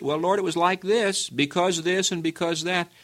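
a high, quick mock excuse to the Lord; excusing sinner. Sinner making excuses to the Lord, high and fast. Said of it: "come and excuse it".

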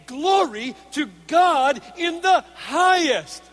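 high, loud, slow proclamation; exultant praise. Acted. Heavenly armies praising God at the birth, loud exultant proclamation. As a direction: ring it out as a host praising God. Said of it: "praising God and saying".

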